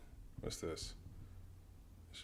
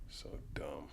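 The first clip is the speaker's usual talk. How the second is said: whispered disgust; 5 semitones lower, 10 dB quieter than usual. Real, not acted. Muttered disgust.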